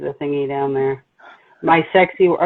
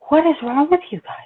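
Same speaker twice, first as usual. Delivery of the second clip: much higher, indignant outburst; salty outburst; 7 semitones higher than usual. Retold or imitated. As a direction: snap at the strangers, pitch leaping up. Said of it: "I get salty".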